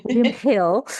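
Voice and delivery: William Hill in exaggerated Cockney; Cockney accent. Shown as a demonstration. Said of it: "you can hear my Cockney accent there".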